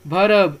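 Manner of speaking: "verb" as two syllables, "va-rub"; wrong pronunciation. Shown as a demonstration. Teacher shows how not to read it, wrong pronunciation. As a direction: sound the R in "verb" with an extra vowel. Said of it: "इसको ऐसा नहीं पढ़ना है".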